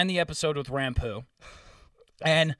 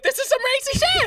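very high, loud exclamation; hammed-up scandalized shout, drawn fast; mock outraged yell; 6 dB louder, faster than usual. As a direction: shriek it in exaggerated disbelief. Retold outraged reaction to show.

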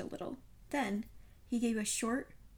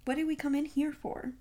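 higher-pitched, pressing question; impatient demand; 6 semitones higher, 4 dB louder than usual. A narrator's reading. Friend asks Poirot why they came in, impatiently. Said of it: "I demanded impatiently".

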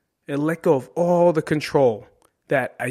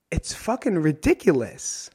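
normal talking voice

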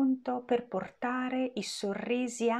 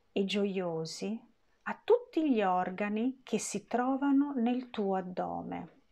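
ordinary talk

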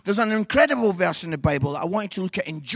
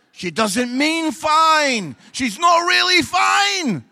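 loud, slow and higher; slow emphatic; 8 semitones higher, 10 dB louder, slower than usual. Real, not acted. Warning the men what fine means, loud slow emphasis. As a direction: lean on every word.

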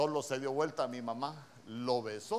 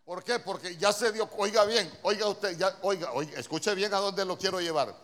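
loud, urgent pulpit preaching; fiery sermon climax; preaching at full force; 5 semitones higher, 7 dB louder than usual. Acted. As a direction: hammer the point to the congregation. Preacher drives the point home.

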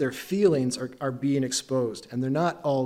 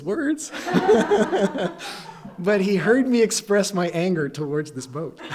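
laughing through the words; chuckling as he admits it, words breaking into laughter; 6 semitones higher, 3 dB louder than usual. Real, not acted. To the congregation about his boat rage, laughing. Laughing at friend's joking question.

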